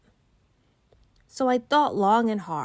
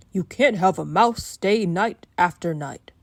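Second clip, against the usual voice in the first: stern father; 7 dB louder than usual. Acted. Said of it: "My father looked stern".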